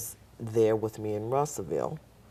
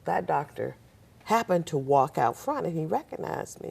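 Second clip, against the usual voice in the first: ordinary delivery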